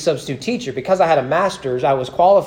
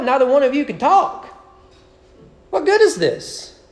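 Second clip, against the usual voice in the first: put-on teacher lecturing kid; 6 semitones higher than usual. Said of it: "I'm like".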